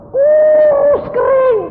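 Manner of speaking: very high, drawn-out, loud; exaggerated squeal. Preacher exaggerates how skinny he stayed, squealing high and slow. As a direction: stretch it into a hammed-up squeal.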